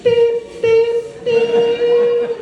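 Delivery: high sung beeps; microwave beeps. Retold or imitated. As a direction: beep like a microwave. Microwave beeping joke.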